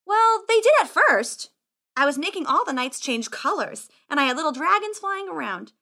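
high-pitched, animated voice; boastful boy wizard. Acted. Wizard defending his show to squire, boastful.

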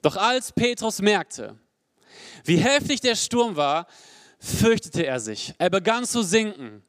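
normal talking voice

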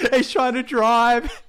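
high, loud, cracking up through the story; laughing while telling. Real, not acted. Describing the film scene to Ben while laughing hard, high and loud. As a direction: barely get the words out for laughing.

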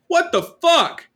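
very high, loud disbelieving outburst; incredulous. Real, not acted. Reacting to the vet's rice field excuse, high disbelieving outburst. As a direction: shriek it in disbelief.

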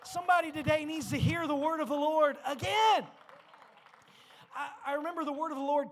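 loud, high preaching call. Acted. Preacher urging the congregation, full preaching voice. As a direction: lift the room, preach it out.

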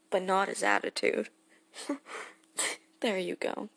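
normal talking voice

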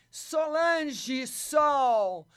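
very slow, loud, drawn-out name; calling out. Real, not acted. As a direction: sing the name out slowly to summon her. Calling the caller into the live.

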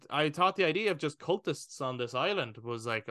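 normal talking voice

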